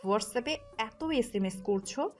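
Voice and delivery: heated accusation with punched words; jealous wife's angry tirade. Acted. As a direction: confront him with proof, crossly. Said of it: "আয়না রেগে গিয়ে বলল".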